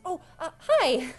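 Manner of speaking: high, hesitant hello; flustered greeting. Acted. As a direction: caught off guard, shyly pleased. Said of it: "she kinda turns and goes".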